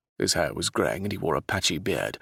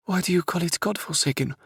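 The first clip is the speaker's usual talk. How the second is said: raised, bewildered princess voice; puzzled princess voice; 12 semitones higher than usual. Acted. Princess asks the captain about her homeland, puzzled. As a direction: ask like a confused young royal.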